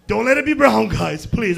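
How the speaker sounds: loud mock plea; acted-out, forceful begging; pleading. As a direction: beg for it out loud. Begging when bombing news broke.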